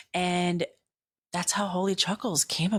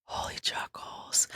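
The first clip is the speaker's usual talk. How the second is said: soft unvoiced whisper repeating it; whispered voice of God; 7 dB quieter than usual. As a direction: whisper the name back like a secret. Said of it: "Tell me again".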